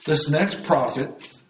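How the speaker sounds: ordinary talk, nothing marked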